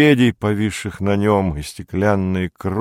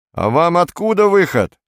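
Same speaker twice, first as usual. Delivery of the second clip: high-pitched, raised retort; stout shop assistant; 10 semitones higher, 4 dB louder than usual. A narrator's reading. Shop assistant in chef's hat to Perets, high and loud. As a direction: ask back loudly in a high voice. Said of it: "спросил дебелый продавец".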